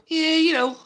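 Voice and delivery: mock Scouse, rather Irish; attempted Scouse accent. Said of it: "That was more Irish".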